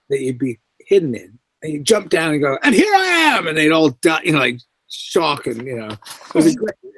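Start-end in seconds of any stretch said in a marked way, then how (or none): 2.6-3.5 high, loud "here I am" burst; surprise shout. Retold or imitated. Ghost leaping from the window at the diners, loud high shout. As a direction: leap out and startle them. Ghost jumps out to scare guests.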